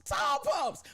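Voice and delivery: high excited call of tall pumps; calling out name. Real, not acted. Hostess greeting friend Tall Pumps, high excited call. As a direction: sing out her name with joy.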